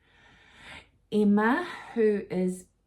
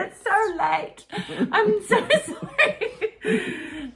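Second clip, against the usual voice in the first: giggling apology; 8 dB louder, much slower than usual. Real, not acted. Giggling after her word slip.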